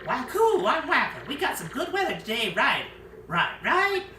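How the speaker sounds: goofy Wacko. Acted. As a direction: chirp it like a loopy islander. Quirky islander character.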